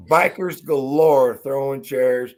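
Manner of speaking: ordinary talk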